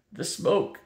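words forced out through a cough. A narrator's reading. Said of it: "Kazul coughed".

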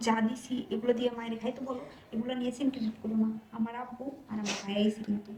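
low, soft urgent talk; hushed pleading. Acted. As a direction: keep it down, guests are near. Hushing husband, father on sofa. (বাবা-ভাই পাশে বসা, তাই চাপা গলায় অনুরোধ)

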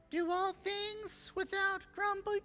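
put-on high, slow singsong kid voice; mock child reciting. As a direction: recite it like a sweet little kid, drawn out. Child reciting verse, mocking.

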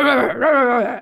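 lightly mocked gruff-dad scolding; play-acted angry dad noises. Shown as a demonstration. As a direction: imitate an angry dad telling off the dog. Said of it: "he calls it angry dad".

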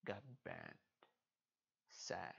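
soft, whispered mock grief; sad whisper. Real, not acted. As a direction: whisper it like a little loss. Favorite card banned.

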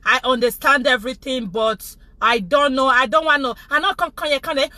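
ordinary talk

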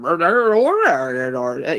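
drawn-out mock Cornish mumble; imitated accent gibberish. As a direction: garble it like an incomprehensible Cornish farmer. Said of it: "speak with a way worse accent".

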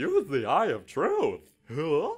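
loud, slow, high zany voice; Mad Hatter voice. Pretend. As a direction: play a loopy madcap hatter. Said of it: "that's the Mad Hatter".

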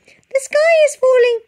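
very high, loud out-of-breath chick; puffing chick. A narrator's reading. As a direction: puff it out breathless. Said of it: "puffed Chicken Licken".

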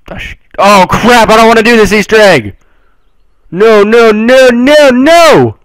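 high, loud panicked wail; pleading no as it goes wrong; rising frantic protest. Real, not acted. Panics at accidental Easter egg.

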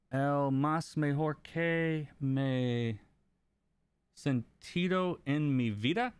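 a lightly voiced try at a Spanish accent, slow and careful; attempted Spanish. Shown as a demonstration. Streamer reading a chat request aloud, in halting attempted Spanish. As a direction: sound out the Spanish carefully, like a hesitant learner. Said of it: "I have a terrible accent".

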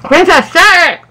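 muffled, cheeks stuffed; mouth full. Real, not acted. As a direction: talk around a mouthful.

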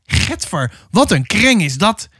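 high-pitched whine after being bitten; wailing complaint. A narrator's reading. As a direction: whine in pained disgust, rising high. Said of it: "jammerde Willem". (jammerend geklaag)

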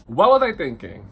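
loud, high, exasperated self-reproach; exasperated outburst. Real, not acted. Regretting the blind date, loud exasperated outburst at herself. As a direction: blurt it out at yourself in disbelief.

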